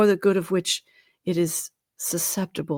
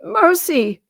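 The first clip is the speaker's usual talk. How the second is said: high, loud startled plea; frightened cry; 13 semitones higher, 8 dB louder than usual. A narrator's reading. Scrooge cries out in fear at the ghost. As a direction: gasp it out in fright.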